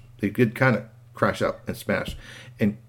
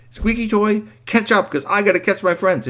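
high, loud hurried call; 11 semitones higher, 6 dB louder than usual. Acted. Boy calling to the robot as he leaves, urgent. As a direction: holler it to the one lagging behind.

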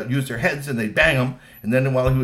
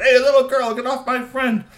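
much higher and louder troll shout; angry shout; 12 semitones higher, 8 dB louder than usual. Acted. Trolls ordering the girl off their friend, shouting. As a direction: bark them off your friend. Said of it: "and Rocky and Bernie said".

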